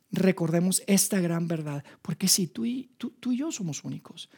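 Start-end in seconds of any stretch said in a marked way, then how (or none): 2.0-4.3 hushed, intimate whisper. Acted. Preacher confides to the listeners in a whisper. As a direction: lean in and whisper it.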